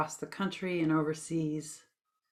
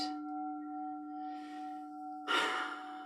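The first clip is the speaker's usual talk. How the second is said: long, airy letting-out sigh; 10 dB quieter than usual. Shown as a demonstration. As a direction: let out a good long exhale. Demonstrates a good sigh while guiding.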